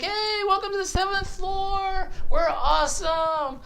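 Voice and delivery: high, drawn-out mock welcome; hammed-up partygoer's hello; party greeter. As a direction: greet them in a long, high singsong. Voices seventh-floor partiers greeting newcomer.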